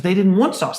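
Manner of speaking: high, loud scolding; retold angry snap. Owner scolding the employee over the sauce, loud. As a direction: mimic the owner barking back. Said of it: "he loses his mind on me".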